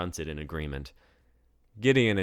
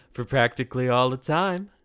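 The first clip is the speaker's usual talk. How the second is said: smirking; 8 semitones higher, 10 dB louder than usual. A narrator's reading. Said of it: "Deidre said with a smirk".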